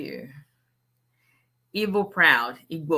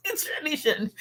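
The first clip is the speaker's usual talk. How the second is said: repeated through real laughter, much higher; laughing; 10 semitones higher, 4 dB quieter than usual. Real, not acted. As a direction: laugh the words out.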